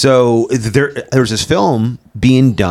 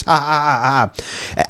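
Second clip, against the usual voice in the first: mock laugh; 3 semitones higher than usual. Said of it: "He's like".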